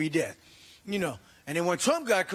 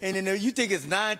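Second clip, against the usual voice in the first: usual speaking voice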